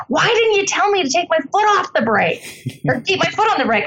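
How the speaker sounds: high-pitched mock outrage at his wife; upset husband. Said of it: "He's upset".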